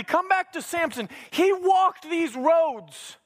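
high, emphatic sermon delivery; impassioned preaching. Real, not acted. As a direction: drive each point home, stretching key words. Preaches at full force.

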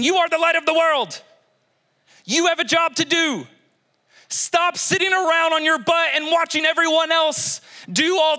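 high, driving exhortation; forceful preaching. Real, not acted. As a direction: preach it hard, urging them to act.

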